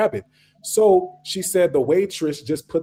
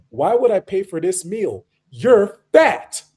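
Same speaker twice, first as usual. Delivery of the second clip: higher acted indignation, building to a loud blunt insult; mock scornful retort; 5 semitones higher, 4 dB louder, slower than usual. African man refuses to pay for his date's meal, scornful. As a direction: imitate a man scornfully shaming his date.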